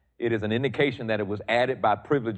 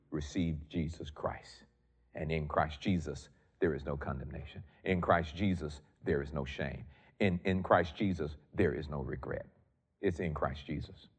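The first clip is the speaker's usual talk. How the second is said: quiet; 6 semitones lower, 8 dB quieter than usual. Real, not acted. Preacher drops to low intense delivery.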